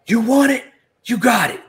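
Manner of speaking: high, loud furious yell; enraged scream. Acted. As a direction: scream it back at him, enraged. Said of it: "An enraged Shamrock screams out".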